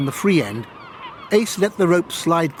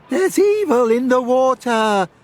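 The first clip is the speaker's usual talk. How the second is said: slow, spooky mock warning; 11 semitones higher, 5 dB louder, slower than usual. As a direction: intone it like a ghost story. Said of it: "laughed the two girls".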